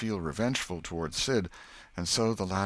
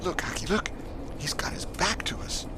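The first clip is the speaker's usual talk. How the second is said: put-on high urgent boyish whisper; breathless excited boy whispering; 13 semitones higher than usual. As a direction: hiss it so the man can't hear. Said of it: "Tom choked off and whispered".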